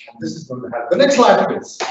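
ordinary delivery

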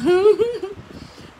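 high amused burst of laughter; laughing. Real, not acted. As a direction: laugh out loud at it. Reacting to tiger's reply.

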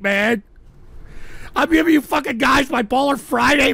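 ordinary delivery

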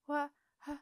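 put-on a flustered boy stammering. A narrator's reading. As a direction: stumble over the start, caught off guard. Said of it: "stammered Beetle".

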